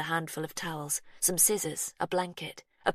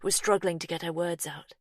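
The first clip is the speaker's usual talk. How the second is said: ordinary delivery, nothing marked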